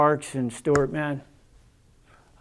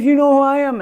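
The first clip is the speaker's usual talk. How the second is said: high, loud challenge; imitated loud demand; 10 semitones higher, 8 dB louder than usual. Officer to inmate she used to supervise, imitated loud demand. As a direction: imitate an officer demanding recognition at full volume. Officer confronts him in retelling.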